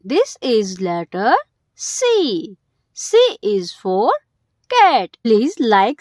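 usual speaking voice

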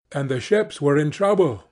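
ordinary delivery, nothing marked

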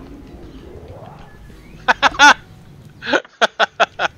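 high, loud laughing fit; long burst of cackling laughs; real laughter. Real, not acted. Laughs at the game.

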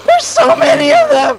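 high panicked yell; panicked shout. Real, not acted. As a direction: shriek at the horde. Swarmed by zombies.

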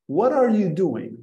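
What are you doing? said as one connected flow; linked and flowing. Shown as a demonstration. Teacher showing learners the linked English question. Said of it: "a nossa tendência ... pronunciar cada palavra".

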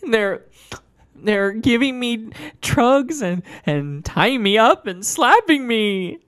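high, slow and pleading; Doctor Fleming's voice. Acted. Trapped patient pleading with Chris, high and slow. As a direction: beg in a high, frantic voice. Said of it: "she explains".

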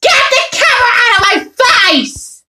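fast high angry shout. Acted. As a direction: yell it at the camera, fast. Shouting at the camera person.

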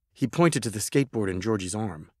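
ordinary delivery, nothing marked

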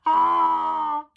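long, high, loud "aah"; giant's cry. A narrator's reading. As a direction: yell out as a tripping giant. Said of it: "cried the giant".

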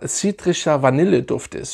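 ordinary talk, nothing marked

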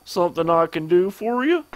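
hammed-up southern businessman; bad southern accent. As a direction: put on a rough southern accent for a game character. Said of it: "I do a bad southern accent".

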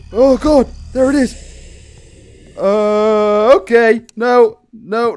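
high, loud dread; frightened. Real, not acted. Player seeing the threat ahead, frightened. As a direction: cry out on seeing it.

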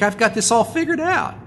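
high-pitched mock self-assurance; smug boast. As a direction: toss off like a cocky golfer. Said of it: "we begin to think".

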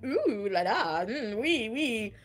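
slow, drawn-out seductive tease; hammed-up flirty cooing; mock sexy ooh la la. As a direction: purr it out slowly. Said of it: "on Messenger going".

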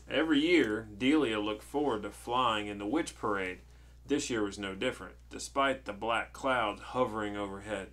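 put-on projected, measured reading to a child; storybook narration. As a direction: read it out clearly like a bedtime tale.

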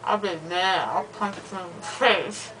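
loopy slurred rambling; drugged, drowsy talk; after wisdom teeth. Real, not acted.

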